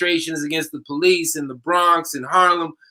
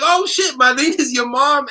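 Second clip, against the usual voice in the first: higher, startled exclamation; shocked realization; 8 semitones higher than usual. Retold or imitated. Teacher realizing the student's mother is Madrina, high surprised. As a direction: caught off guard by the news. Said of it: "And I was like".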